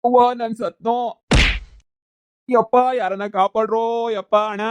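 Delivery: hammed-up cry for help; screaming for help. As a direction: call out so the whole village hears. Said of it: "ನೀನ್ ಹಿಂಗ್ ಕಿರುಚ್ತೀಯಾ?".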